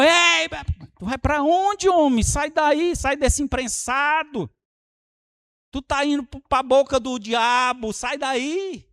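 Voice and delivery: urgent warning shout. Retold or imitated. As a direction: yell to stop him before the sin. Preacher shows warning to brother.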